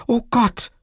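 high-pitched exclamation; alarmed cry. A narrator's reading. Livia notices the gas, high alarmed cry. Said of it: "rief Livia".

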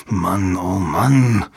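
slow, drawn-out exasperation; cursing. A narrator's reading. Jabba curses at the riddle, exasperated. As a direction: curse under the strain, stretching each word. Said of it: "fluchte Jabba".

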